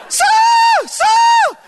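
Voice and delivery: imitated high, stretched-out shriek; calling out. Cashier calling the preacher across the supermarket, shrieking. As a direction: mimic a woman hailing someone from afar.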